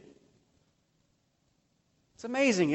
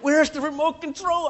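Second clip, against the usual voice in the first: higher mock panic over a lost remote; mock freaking out; 8 semitones higher, 5 dB louder than usual. Pastor panics to his wife about the remote, mock frantic. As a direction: play up the panic, comically. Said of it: "I'm like".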